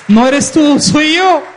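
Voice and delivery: loud, high preaching climax; triumphant proclamation. Retold or imitated. Preacher repeats Jesus's assurance after calling for applause, loud and triumphant. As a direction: cry it out to the whole congregation.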